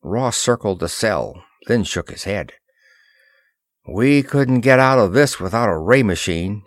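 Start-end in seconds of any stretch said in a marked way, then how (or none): none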